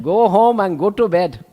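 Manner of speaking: put-on loud, high Indian accent. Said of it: "that Indian voice where he says".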